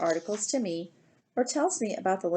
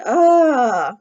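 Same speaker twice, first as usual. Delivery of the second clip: very loud, higher "ah" of delight; delighted shout; 5 semitones higher, 13 dB louder than usual. A narrator's reading. Girl thrilled at Dad's Big Mac offer, shouting. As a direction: let out a long, loud happy cry. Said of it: "And I shouted".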